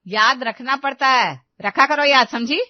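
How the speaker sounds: very loud, high reprimand; cross, emphatic telling-off; scolding. Acted. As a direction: drive the lesson home sternly. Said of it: "शांति ने उसे डांटा है".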